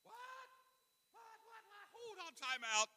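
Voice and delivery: squeaky high cartoon-character yelp; a startled cartoon squeak. Real, not acted. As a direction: voice cracking up high by accident. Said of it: "I sound like a cartoon character".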